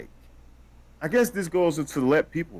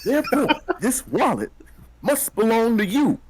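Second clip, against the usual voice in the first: higher, laughing through a silly quote; laughing mock quote; 9 semitones higher than usual. Quoting the wallet note to his co-host, laughing high. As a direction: giggle out the finder's logic.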